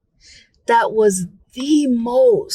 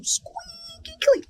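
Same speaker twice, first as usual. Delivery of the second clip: a tiny mock-squeaky voice; high squeaky mock voice on squeaky clean; 8 dB quieter, slower than usual. Real, not acted. Describing the pristine house.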